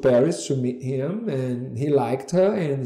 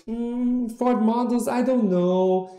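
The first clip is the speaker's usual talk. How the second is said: higher-pitched, dismissive mock hesitation; snooty attitude; 5 semitones higher than usual. Would-be model brushing off Ford to the agent, with attitude. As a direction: imitate a dubious girl looking down on the agency. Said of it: "She had a bit of an attitude".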